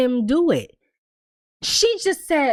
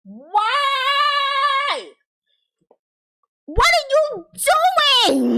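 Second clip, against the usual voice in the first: high, stretched cry of disbelief at the screen; exasperated disbelief; 13 semitones higher, much slower than usual. Real, not acted. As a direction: plead with the TV character, voice shooting up. Yelling at Victor on screen.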